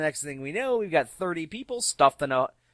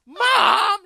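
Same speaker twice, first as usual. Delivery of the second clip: loud, high whining "Mom!"; whiny shout; 13 dB louder than usual. Retold or imitated. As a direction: yell it like a kid caught out. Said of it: "And Samuel's like".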